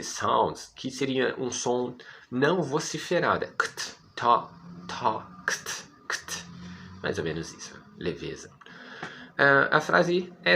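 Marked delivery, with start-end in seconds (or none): 3.5-6.4 kt voiceless, just a puff of air; kt, kt, light breathy clicks; unvoiced kt. Shown as a demonstration. Teacher demonstrating the unvoiced ending sound to students. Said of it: "unvoiced consonant".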